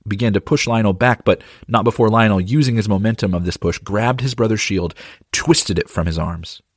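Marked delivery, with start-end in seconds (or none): none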